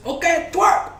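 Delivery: high, loud party MC; host a twerk contest on stage, all showman; hyped MC voice. Retold or imitated. MC starting the contest.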